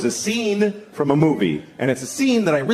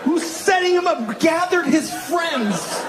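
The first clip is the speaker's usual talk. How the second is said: high, strained comic outrage; exasperated rant; 11 semitones higher than usual. Real, not acted. Comic arguing the prank makes no sense, exasperated. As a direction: cry out the objection up high. Comic ranting about the scene.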